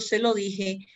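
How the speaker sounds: ordinary delivery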